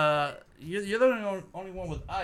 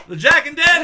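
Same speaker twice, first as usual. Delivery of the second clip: higher, louder showman welcome; announcer shout; 12 semitones higher, 9 dB louder than usual. Pretend. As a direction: announce it to the crowd. Welcoming everybody to the show.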